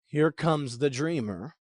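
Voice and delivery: low, subdued scorn, lightly acted; bitter brothers. Brothers spot the dreamer coming, quietly bitter. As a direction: say it slowly, with resentment held down. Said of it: "they're still bitter about that".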